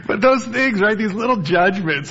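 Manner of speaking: loud, high, through laughter; laughing. Real, not acted. Pastor laughing at congregation's bike joke. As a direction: laugh it off loudly.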